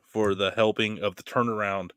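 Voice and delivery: usual speaking voice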